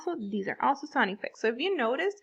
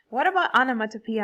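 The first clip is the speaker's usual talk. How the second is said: usual speaking voice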